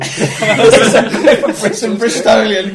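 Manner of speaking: stretched out through laughter; laughing. Real, not acted. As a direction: say it while cracking up. Laughing about the accent.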